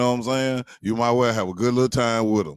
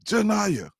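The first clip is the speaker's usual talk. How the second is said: yelling correction; 13 semitones higher than usual. Retold or imitated. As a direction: shout the name back from the other room. Daughter yelling name from other room.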